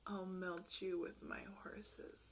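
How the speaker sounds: slow hushed reading; baffled whisper. Retold or imitated. Reading a strange Tinder bio, a slow stunned whisper.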